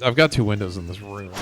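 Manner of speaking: normal talking voice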